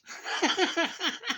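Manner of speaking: squealing high-pitched laugh. Real, not acted. Laughing at his own April Fools story. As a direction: burst into a high giggle.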